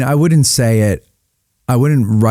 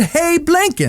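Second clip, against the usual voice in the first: much higher, far-off comic voice; squeaky mock call; slower than usual. Cohost replaying the movie greeting in a silly voice. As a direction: squeak the name out as a joke. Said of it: "I said".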